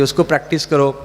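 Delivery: usual speaking voice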